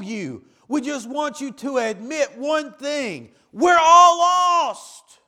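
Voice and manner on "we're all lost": drawn-out shouted cry, much higher and louder; preaching shout. Real, not acted. Preacher crying out to the congregation, slow full shout. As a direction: proclaim it slowly at full voice.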